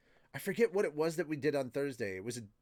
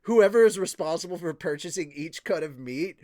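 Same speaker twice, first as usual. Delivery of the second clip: mock rapturous praise; 6 semitones higher, 10 dB louder than usual.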